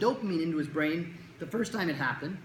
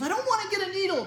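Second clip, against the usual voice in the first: put-on high, louder whining boy; whiny kid; 14 semitones higher, 5 dB louder than usual. Kid refusing the needle to the doctor, high whine.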